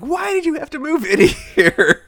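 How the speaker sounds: high-pitched, laughing imitation of panic; mock losing it. Matthew Perry's character to his new neighbor, mock losing it. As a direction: wail it as a hammed-up freak-out. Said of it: "Matthew Perry just losing it".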